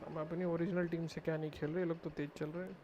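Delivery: ordinary delivery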